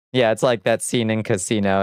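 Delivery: ordinary talk, nothing marked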